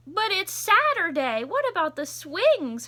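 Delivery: put-on high, loud whiny child; little brother protesting. Little brother calling after Tilly, high and whiny. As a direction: plead like a disappointed little kid. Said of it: "her brother called".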